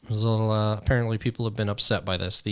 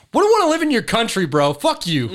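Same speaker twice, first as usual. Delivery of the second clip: imagined retort; 9 semitones higher, 7 dB louder than usual. Retold or imitated. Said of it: "I'm just like".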